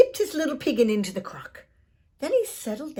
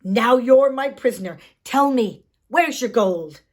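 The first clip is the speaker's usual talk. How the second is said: loud, gleeful capture; triumphant cry; 3 semitones higher, 11 dB louder than usual. Acted. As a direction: crow it out as you grab him. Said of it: "grabbed the shy fellow and cried".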